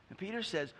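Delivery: ordinary talk, nothing marked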